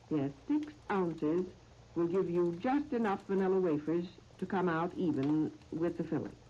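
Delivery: ordinary delivery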